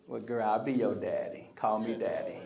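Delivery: low, soft come-on; smooth-talking suitor. Acted. Bobo to a teenage daughter shut out by dad, smooth come-on.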